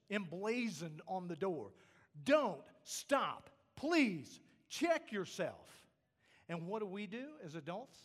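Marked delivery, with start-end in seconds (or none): 2.2-5.9 louder, slowed command, Don't hit hard; God's warning, loud and slow. Acted. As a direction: call out the warning like a red sign. Voicing God's warning loudly.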